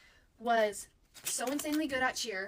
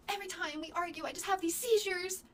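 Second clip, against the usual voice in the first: imitated complaining mom; 7 semitones higher, 3 dB louder than usual.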